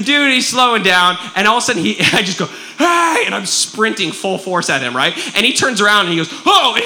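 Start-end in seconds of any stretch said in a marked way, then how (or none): 2.8-3.2 high, drawn-out retold yell; calling out. Calls after the fleeing thief. 6.4-6.7 high, loud imitated yelp; mock startled. Thief turns, startled.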